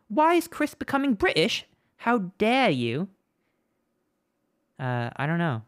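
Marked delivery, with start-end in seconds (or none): 0.1-1.6 the line again in a higher, hammed British accent; mock British accent. Streamer answers chat's joke in a mock British accent. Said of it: "why I'm becoming British". 2.0-3.1 mock British outrage.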